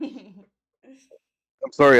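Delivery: talking through a mouthful of water; words round a mouthful of water; mouth full. Real, not acted. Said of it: "I have a mouthful of water".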